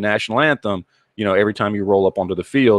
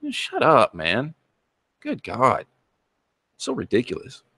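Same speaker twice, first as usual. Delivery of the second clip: ordinary talk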